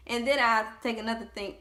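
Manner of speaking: ordinary delivery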